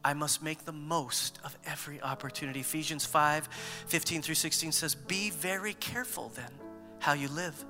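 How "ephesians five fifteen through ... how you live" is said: ordinary talk, nothing marked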